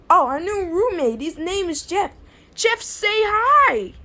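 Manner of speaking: high, lively cartoon voice; introducing roommate voice. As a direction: present him, slowing on the greeting.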